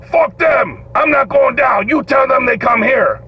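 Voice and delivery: high, angry refusal; defiant inmate. Retold or imitated. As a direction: holler back at the guard, refusing to budge. Inmate on strike refusing angrily.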